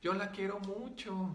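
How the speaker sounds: high, pleading imitation of a child; little girl's voice. Spirit tells healer it loves the student, imitated little-girl plea. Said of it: "gritar con una voz de niña".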